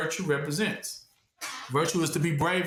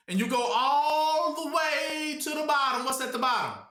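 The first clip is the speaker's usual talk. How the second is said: booming preacher; 7 semitones higher than usual. Acted. Preacher driving point to the deep.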